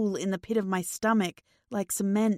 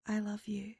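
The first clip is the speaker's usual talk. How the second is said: whispered, barely voiced; 12 dB quieter than usual. A narrator's reading. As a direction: breathe it softly to him. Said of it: "I whisper".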